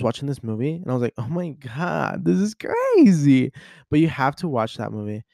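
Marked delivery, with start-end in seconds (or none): 1.2-3.5 slow, drawn-out disbelief, climbing high and loud; retold shock. Vlogger reliving a one A M movie, exaggerated shock. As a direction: stretch it out and build to a high, loud "crazy". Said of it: "I was very shocked".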